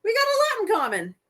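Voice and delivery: high, insistent movie dame; hammed-up old-film patter; Ma Kelly. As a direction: argue back in character. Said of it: "She's like".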